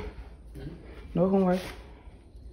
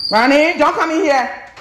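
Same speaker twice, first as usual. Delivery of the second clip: calling out; 11 semitones higher, 5 dB louder than usual. Real, not acted. Calling out to Ronnie.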